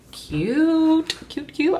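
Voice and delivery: high squeal of delight. Real, not acted. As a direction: gush over how cute it is. Delighted at the layout.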